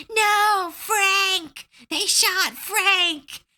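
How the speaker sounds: very high, shrill-free squeaky goblin wail; high goblin voice mourning his friend; panicked goblin. Retold or imitated. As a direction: cry out as a frantic little goblin. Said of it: "one of the goblins starts going".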